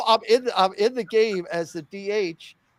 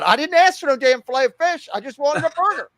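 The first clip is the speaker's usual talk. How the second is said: raised, higher retold shout; mock yell; 7 semitones higher, 5 dB louder than usual. Kid yelling at coach over a burger, loud and high. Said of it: "I remember saying real loud".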